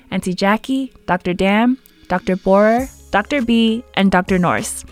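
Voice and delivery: ordinary talk, nothing marked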